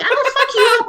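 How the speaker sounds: high, loud mock threat from the lady; furious housewife. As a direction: play the homeowner screeching a threat. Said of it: "And the lady's like".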